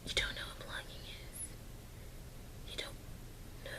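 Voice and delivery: unvoiced incredulous whisper. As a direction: whisper it in disbelief. Repeats the line as a whisper.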